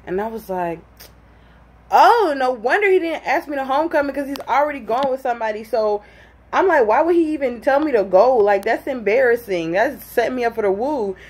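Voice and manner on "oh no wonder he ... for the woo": high, animated retold outrage; worked-up, high-pitched recounting of embarrassment; indignant realization. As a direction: act out it suddenly dawning, voice pitched up. Acts out her indignant realization.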